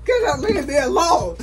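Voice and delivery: loud, exasperated yell; angry shout. Real, not acted. Grandma yelling at her teasers over keys, angry shout. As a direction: yell to drive them off.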